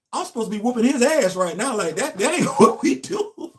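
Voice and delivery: high, loud disbelief breaking into laughter; fired up and laughing at once; retelling his reaction, loud and amused; incredulous. Retold or imitated. Retold inner outrage.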